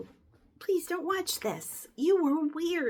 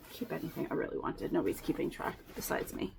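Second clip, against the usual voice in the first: whisper; 10 semitones lower, 8 dB quieter, faster than usual. Real, not acted. Conspiratorial aside to camera.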